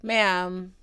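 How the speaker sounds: ordinary talk, nothing marked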